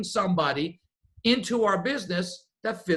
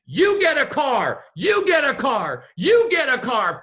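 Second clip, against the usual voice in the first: lightly imitated TV host shouting a giveaway, higher and laughing; Oprah Winfrey giveaway; 5 semitones higher, 5 dB louder than usual. Celebrity host handing out cars to a wild audience, mock shout. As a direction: hype up each prize like a talk show host. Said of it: "you could have sworn that Oprah Winfrey walked into the restaurant and said".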